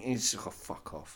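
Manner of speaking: ordinary delivery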